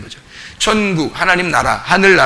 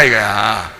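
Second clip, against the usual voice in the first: normal talking voice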